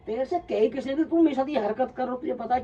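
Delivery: high, loud, angry confrontation; telling off the prankster friend in a furious yell; furious retold yell. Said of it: "इतना गुस्से में था".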